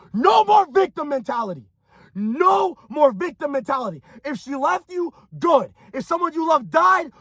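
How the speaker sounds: high-pitched, full-throated rallying cry; impassioned motivational shouting. Real, not acted. Coach telling listeners to use their anger, impassioned shouting.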